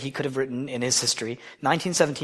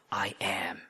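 sacred hush; reverent whisper; 7 semitones lower, 11 dB quieter than usual. Acted. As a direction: breathe the words in a hushed whisper. Whispering God's name in sermon.

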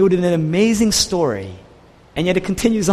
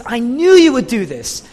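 higher, louder retold accusation; angry accusation; 5 semitones higher, 5 dB louder than usual. Preacher as Jonah accusing God, angry. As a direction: throw the blame up at God, acted. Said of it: "he's so angry".

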